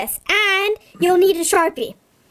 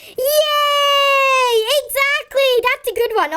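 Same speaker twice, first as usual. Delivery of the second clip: much higher, shouted yay; excited cheer; 9 semitones higher than usual. Pretend. Celebrating the found rhyme.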